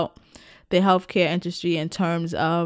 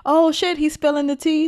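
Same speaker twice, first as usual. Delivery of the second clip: higher, louder gossip thrill; excited gossip; 9 semitones higher, 3 dB louder than usual. Retold or imitated. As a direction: gasp like hearing hot gossip. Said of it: "they were like".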